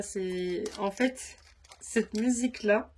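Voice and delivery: normal talking voice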